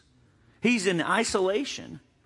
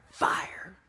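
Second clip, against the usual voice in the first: dramatic whisper; 5 dB quieter than usual. Pretend. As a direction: fire breathed out in a whisper. Answered prayer, dramatic whisper.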